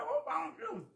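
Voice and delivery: words muffled by a mouthful of food, high and laughing; talking to viewers while chewing, mouth full. Real, not acted. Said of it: "I had food in my mouth".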